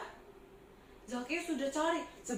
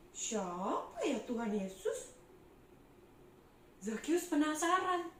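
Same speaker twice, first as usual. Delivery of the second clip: usual speaking voice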